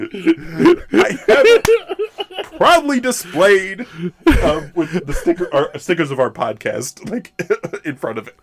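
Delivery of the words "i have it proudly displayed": high, loud, slow words through laughter; laughing boast. Real, not acted. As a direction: crack up while proudly confessing. Boasting through laughter about toy.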